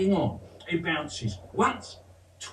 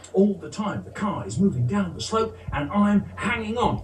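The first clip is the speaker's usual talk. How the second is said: ordinary delivery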